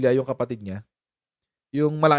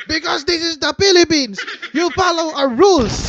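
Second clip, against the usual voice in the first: high, louder mock scolding; imitating angry officer; 11 semitones higher, 5 dB louder than usual. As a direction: snap back like an indignant official. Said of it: "Sagot yung taga-immigration". (galit na panggagaya sa taga-immigration)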